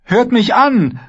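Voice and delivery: hoch und laut flehend. Acted. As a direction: verzweifelt um Gehör bitten. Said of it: "flehte Brienne". (Brienne fleht die Söldner an, hoch und laut)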